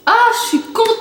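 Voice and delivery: high, sing-song burst of delight. Real, not acted. Vlogger thrilled she likes the snack, delighted. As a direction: let happiness lift it into a sing-song.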